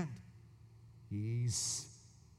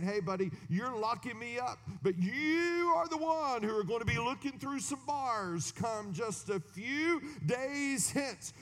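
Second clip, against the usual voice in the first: higher, cocky mock taunt; sarcastic retort; 9 semitones higher than usual. Micaiah taunting the king who jails him, sarcastic.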